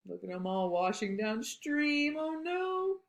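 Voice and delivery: usual speaking voice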